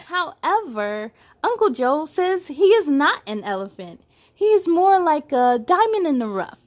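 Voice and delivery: bright read-aloud storytelling; picture book read to little kids; story narration. A narrator's reading. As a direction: read it to children, lively and clear.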